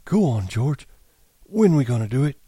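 put-on high, childlike Lenny voice; eager simple giant; Lenny's voice. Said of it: "Lenny voice".

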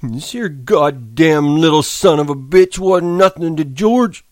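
loud slow hurt outburst; Lenny, upset. Acted. Lenny scolds the dead pup, loud and slow. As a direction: blame the little pup in frustration.

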